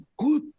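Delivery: a sudden startled gasp; sharp gasp. Retold or imitated. Retelling the plane dropping, a sharp gasp.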